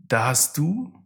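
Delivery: ordinary talk, nothing marked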